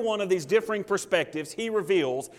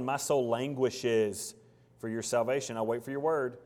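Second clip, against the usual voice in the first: weary lament; 5 semitones lower, 3 dB quieter than usual. Acted. Discouraged psalmist reading.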